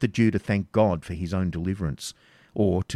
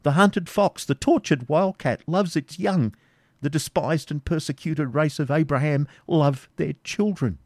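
put-on higher, earnest old father; eager pleading; 8 semitones higher than usual. Isaac pressing the baron about his children, earnest and high. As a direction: press the point with feeling. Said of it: "eager to improve the moment".

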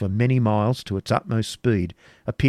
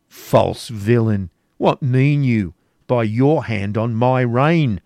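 slow, louder, furious rebuke; angry; 2 semitones higher, 6 dB louder, slower than usual. A narrator's reading. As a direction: snap the words out at the traitor with slow menace. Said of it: "said Sir Brian angrily".

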